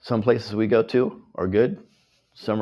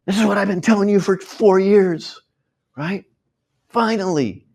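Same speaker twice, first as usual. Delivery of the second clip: high, loud vindicated nagging; finally proven right after years, make them hear it; exasperated told-you-so; 11 semitones higher, 6 dB louder than usual. Retold or imitated. To friends and family after years of warnings, a high loud vindicated jab. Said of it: "we just want to go".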